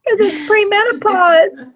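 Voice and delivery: high voice breaking into tears; emotional, crying. Real, not acted. Blaming premenopause for the tears, crying for real. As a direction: cry while excusing yourself for it. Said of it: "I'm gonna get all emotional again".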